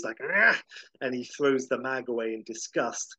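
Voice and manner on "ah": high, loud angry squawk; aggressive duck squawk. Retold or imitated. Howard tossing the magazine with a loud, high angry squawk. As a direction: bark it out in disgust. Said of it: "he makes an aggressive Howard noise".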